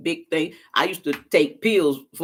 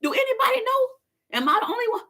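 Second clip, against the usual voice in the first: bewildered wondering. Retold or imitated. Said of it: "Now I feel like".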